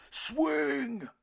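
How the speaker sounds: loud, high shout of swing; hollering. A narrator's reading. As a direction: yell it from the stands. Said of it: "hollered Papa".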